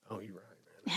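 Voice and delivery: awed whisper. Retold or imitated. He admits to his sponsor he was right, in an awed whisper. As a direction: let the realization hush you to a whisper.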